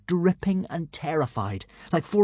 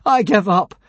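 louder call back; shouting back; 5 semitones higher, 6 dB louder than usual. Acted. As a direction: shout it into the wind. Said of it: "Hiccup shouted back".

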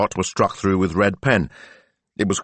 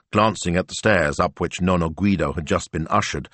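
usual speaking voice

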